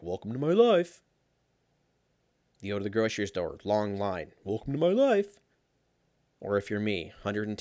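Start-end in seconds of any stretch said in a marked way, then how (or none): 0.0-1.0 higher, put-on fed-up catchphrase; mock exasperation. Acted catchphrase of everyday exasperation. 4.4-5.4 high, put-on fed-up catchphrase; mock exasperation. Acted catchphrase, repeated bit.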